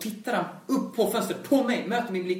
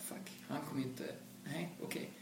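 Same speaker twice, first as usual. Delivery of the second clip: much quieter, half-voiced grumble; muttered to oneself; 4 semitones lower, 16 dB quieter, faster than usual. Waiting alone in the rehearsal room, muttering much quieter. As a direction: swear under your breath in disappointment. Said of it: "Så jag bara".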